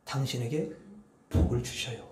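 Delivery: gentle quiet blessing; tender hushed blessing; soft blessing. Shown as a demonstration. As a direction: murmur it lovingly. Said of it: "뭐라고 해야 돼".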